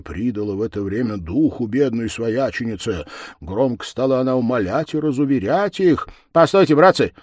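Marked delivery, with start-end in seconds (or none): 6.3-7.1 higher, louder pleading clerk; quick, raised voice holding the others back; clerk's voice. Acted. Clerk urges his fellows to wait, raised and hurried. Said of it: "сказал писарь".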